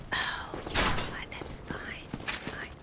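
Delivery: unvoiced resigned whisper. Real, not acted. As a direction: let it go in a whisper. Muttered resignation while gaming.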